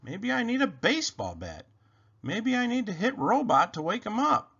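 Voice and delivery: a hint of an excited little rabbit; lightly voiced Rabbit. A narrator's reading. Rabbit wondering aloud how to wake Robot, lightly voiced.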